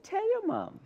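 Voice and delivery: high, laughing comeback; teasing a cheeky child through laughter; laughing retort. Retold or imitated. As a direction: toss it back with a laugh.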